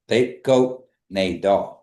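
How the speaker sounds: slowly, each word separated; slow model phrase. Shown as a demonstration. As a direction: say "They go nay dog" slowly, word by word. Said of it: "Just practice that last part".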